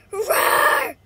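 high, loud roar; animal roar. Pretend. Roaring as an animal.

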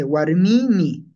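"Warmimi" with the middle i held very long; stretched I. Shown as a demonstration. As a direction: slowly draw out mii in "Warmimi". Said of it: "están estirando".

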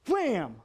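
loud, punchy wham; imitated impact. Dad retells block flying at his head, explosive impact. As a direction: slam it out like a hit.

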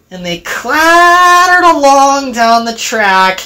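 ordinary delivery, nothing marked

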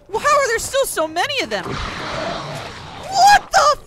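high, loud, stretched-out disbelief; horrified yelling at the mob; shocked outrage. Real, not acted. Gamer overwhelmed by an enemy horde, shrieking in disbelief.

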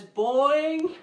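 loud springy boing, rising; kangaroo boing. Shown as a demonstration. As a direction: hop it out loud. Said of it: "Let me hear some kangaroo sounds".

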